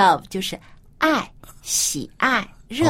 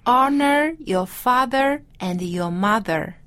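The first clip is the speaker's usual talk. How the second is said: slow, careful repeat of the line; slow repeat-after-me; 4 semitones higher, slower than usual. Shown as a demonstration. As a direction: echo it back slowly, word by word. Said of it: "Let's say this".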